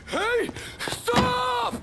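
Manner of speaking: loud, very high desperate yell; frantic warning cry; panicked shout. Acted. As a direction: scream to stop her. Seeing wife near car bomb.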